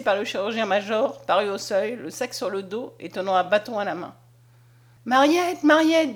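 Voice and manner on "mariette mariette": higher, louder joyful call; bursting in, calling to a loved one; excited calling out. A narrator's reading. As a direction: call her name out eagerly. Conscience bursts in with great news.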